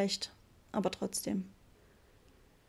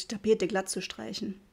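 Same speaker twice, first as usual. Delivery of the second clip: usual speaking voice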